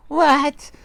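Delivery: high, loud horrified wife; gasped question. A narrator's reading. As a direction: gasp it high in alarm. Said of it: "gasped Miss Twit".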